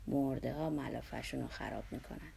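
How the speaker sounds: low, firm self-justification; grim, resolute low voice; determined, defensive. A narrator's reading. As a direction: state it low, as if defending yourself.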